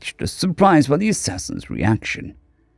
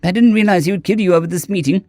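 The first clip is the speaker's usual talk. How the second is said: a flustered, stuttering no, pitched higher; stumbling over words; 7 semitones higher than usual. A narrator's reading. Shaz to Cole, stumbling over his words in a rush. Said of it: "Shaz stumbled over his words".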